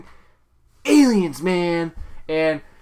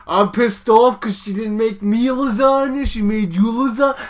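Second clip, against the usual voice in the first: higher, aggrieved brother impression; Brad Garrett; 4 semitones higher than usual. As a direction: gripe like a jealous sitcom brother. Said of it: "Brad Garrett would be like".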